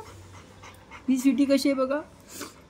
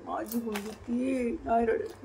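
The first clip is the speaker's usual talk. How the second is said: slow soft reassurance; 7 dB quieter, slower than usual. Real, not acted. Soothing the daughter.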